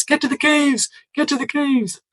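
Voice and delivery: very high-pitched squeaky mock cry; mock rallying cry. Reviewer mocking the book's back-to-nature ending, high falsetto cry.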